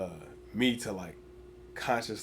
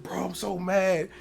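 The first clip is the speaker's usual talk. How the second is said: mock angry teammate; 9 semitones higher, 6 dB louder, much slower than usual. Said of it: "he was like".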